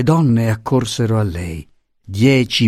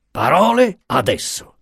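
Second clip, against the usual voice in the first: raised-pitch threatening retort; indignant, nearly threatening; almost menacing; 6 semitones higher than usual. A narrator's reading. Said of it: "avanzandosi quasi minaccioso".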